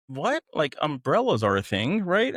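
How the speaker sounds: high, incredulous retelling; baffled disbelief. Retold or imitated. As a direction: question it as if it's absurd. Retold baffled reaction to request.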